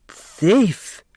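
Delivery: high accusing cry; secretary crying thief at Mrs Wexler; cried out. A narrator's reading. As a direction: fling the accusation at her. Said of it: "Sidel cried".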